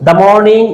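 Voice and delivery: "The" said "dah" before morning. Shown as a demonstration. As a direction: say "The" with an ah vowel. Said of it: "আর এটা হবে দা".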